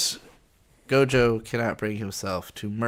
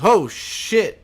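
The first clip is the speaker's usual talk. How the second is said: a much higher, louder startled curse; shocked reaction; 8 semitones higher, 9 dB louder than usual. Retold or imitated. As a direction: blurt it out, caught off guard. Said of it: "type of moments".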